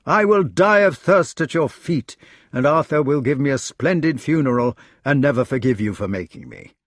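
lightly voiced Guinevere. Guinevere vowing death and a nunnery to Lancelot, theatrical.

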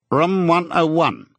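high, drawn-out official call; officer calling out a room. Acted.

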